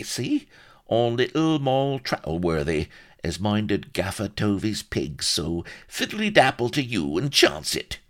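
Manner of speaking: high, wheedling country voice; shifty old rustic. A narrator's reading. Solomon pleads he was married already, shifty rustic whine.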